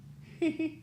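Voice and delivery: high burst of laughter; mocking laugh. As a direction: laugh at him. Laughs at kid.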